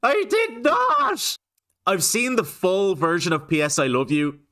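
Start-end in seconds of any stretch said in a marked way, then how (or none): 0.0-1.4 high, drawn-out mimicked yell; exaggerated, slow parody of an indignant cry; mock outraged shout. Mimicking the film's arguing husband, mock outraged shout.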